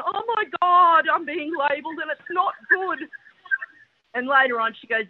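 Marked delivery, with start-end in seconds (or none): 0.1-3.0 hammed-up horror at being labelled; freaking out. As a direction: wail it in comic alarm. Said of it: "So I'm freaking out".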